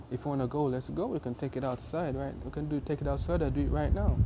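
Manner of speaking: plain reading voice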